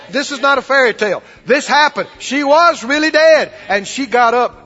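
high, loud preaching; preaching at full force. Acted. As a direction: drive the story home at full voice.

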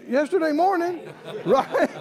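ordinary delivery

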